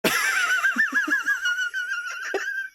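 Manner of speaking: extremely high squealing laugh; long helpless laughing wheeze; uncontrollable laughter. Real, not acted. Streamer hit by Joker's laughing gas, uncontrollable laughter. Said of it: "got hit by the fucking Joker's laughing gas".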